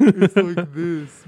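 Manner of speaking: slow, laughing attempt at a deep voice; forced deep voice. Shown as a demonstration. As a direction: push the voice down and laugh through it. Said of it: "try to get lower".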